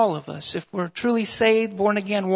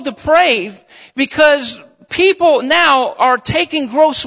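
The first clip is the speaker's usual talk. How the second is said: raised, insistent pulpit voice; forceful preaching; 4 semitones higher, 5 dB louder than usual. Real, not acted. Preacher warns brothers and sisters of a dark hour, forceful. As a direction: preach it with mounting urgency, punching key words.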